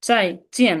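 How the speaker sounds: চাই and চেন both falling sharply; two fourth tones. Shown as a demonstration. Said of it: "দুইটা কি four tone?".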